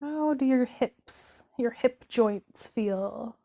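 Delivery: slow, unhurried meditation voice with drawn-out words; guided body scan. Acted. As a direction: guide them gently through the body, leaving room to feel.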